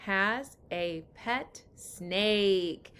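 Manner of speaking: slow, stretched word-by-word reading; teacher reading with the class; slow choral reading. Shown as a demonstration. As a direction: read it slowly together. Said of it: "Let's read it again".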